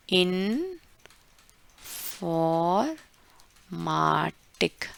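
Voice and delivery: Informatic drawn out very slowly, in-for-ma-tik; slow syllable demo. Shown as a demonstration. Teacher slowly spelling out German Informatik. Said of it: "ये क्या है हमारा?".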